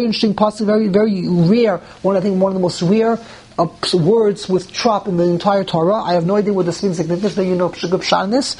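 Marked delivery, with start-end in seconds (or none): none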